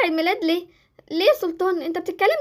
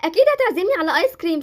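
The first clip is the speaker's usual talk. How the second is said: ordinary talk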